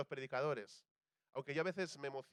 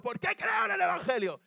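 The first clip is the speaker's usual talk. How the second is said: evangelist's shout; 10 dB louder than usual. Retold or imitated. Evangelist shouting a question at a huge congregation. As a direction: bellow it at two thousand people. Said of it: "estaba el evangelista gritando".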